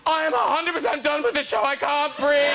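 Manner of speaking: high-pitched melodramatic outburst; mock hysterics, pitched high; distraught fan. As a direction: wail it like a devastated fan. Reads out a distraught fan's tweet.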